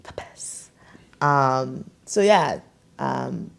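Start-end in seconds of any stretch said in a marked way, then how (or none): 0.0-0.7 whisper. Real, not acted. Whispered aside.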